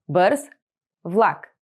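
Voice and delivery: Бърз, then влак after a pause; slow, split Бърз влак; word by word. Shown as a demonstration. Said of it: "teacher splits phrase word by word".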